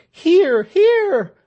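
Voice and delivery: very high, loud approving shouts. A narrator's reading. The crowd approving Tell's speech, loud high shouts. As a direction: cheer him on from the crowd. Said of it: "Shouts of".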